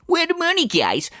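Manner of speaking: high mock whine for cash, dropping at the end; mock pissed-off streamer. Streamer pissed at viewers for not giving money, mock angry. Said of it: "get pissed at your viewers".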